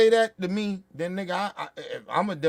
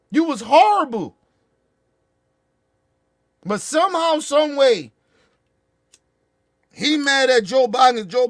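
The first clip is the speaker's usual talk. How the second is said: loud, high yelling at him; shouting insults; 5 semitones higher, 6 dB louder than usual. Real, not acted. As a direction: bellow every insult. Yelling insults at Chris Christie.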